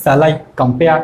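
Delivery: low "Shall I compare", I and -pare stressed; "Shall I compare" in two measured feet, weight on I and -pare; iambic stress. Shown as a demonstration. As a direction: scan "Shall I compare" in weak-strong beats. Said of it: "যেখানে জোরে হচ্ছে".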